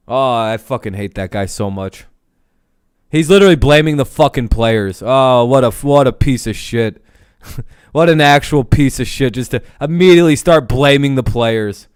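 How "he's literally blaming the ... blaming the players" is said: real anger. Real, not acted. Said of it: "that makes me so angry".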